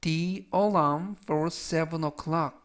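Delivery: slow, careful English model sentence; slow model reading. Shown as a demonstration. Teacher reading a model sentence slowly for students. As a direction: read each word out clearly for learners.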